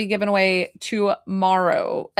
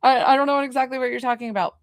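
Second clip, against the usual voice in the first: higher, quick, mock confused; high flustered reply; 6 semitones higher, 3 dB louder, faster than usual. Answering the spirit, high and rushed in mock confusion. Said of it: "I'm like".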